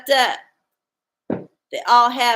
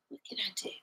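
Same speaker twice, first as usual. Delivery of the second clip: breathy unvoiced whisper to herself; 2 semitones lower, 17 dB quieter, much faster than usual. Real, not acted. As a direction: ask it under your breath.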